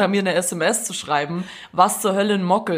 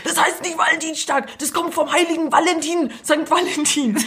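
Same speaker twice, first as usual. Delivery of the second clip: high-pitched imitation of everyone correcting; imitated know-it-all chorus, pitched up; mock exasperated correction; 7 semitones higher than usual. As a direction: correct them in a raised, put-on voice. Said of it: "Und alle immer so".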